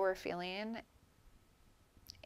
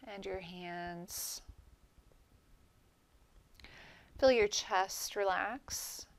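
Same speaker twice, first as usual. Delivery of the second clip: calm meditation guide, long pauses between phrases; guided meditation. Real, not acted. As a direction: gently lead them through relaxing each body part, letting phrases settle into silence. Leads guided breathing relaxation meditation.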